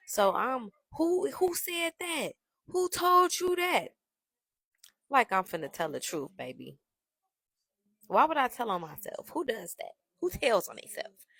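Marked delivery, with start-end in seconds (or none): 1.0-3.9 high, drawn-out mock disbelief; feigned innocence. Playing innocent to boyfriend on phone. 8.1-11.2 higher-pitched feigned disbelief; mock innocent. Playing innocent to boyfriend on phone.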